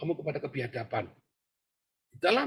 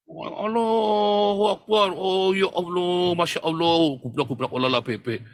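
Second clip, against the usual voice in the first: loud, very slow drawn-out exclamations; mocking, drawn-out cries of Allahu Akbar; mock loud prayer; 4 semitones higher, 6 dB louder, slower than usual. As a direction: ham up a careless pious outcry.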